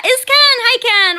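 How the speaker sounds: high, loud delighted hello; excited greeting. Real, not acted. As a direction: burst out happily as a friend arrives. Greeting a viewer who arrives.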